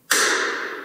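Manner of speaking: sharp audible gasp; shocked gasp. Real, not acted. Viewer stunned by a twist, shocked gasp. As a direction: suck in breath in shock.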